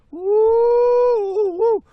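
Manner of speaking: a hammed-up patriotic cheer; mock cheering. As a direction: whoop like a fan at a game. Cheering for American car.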